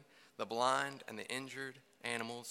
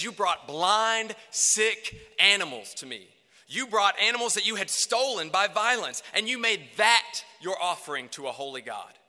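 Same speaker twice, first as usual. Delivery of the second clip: loud rebuke; 6 semitones higher, 8 dB louder than usual. Acted. God spelling out their sins.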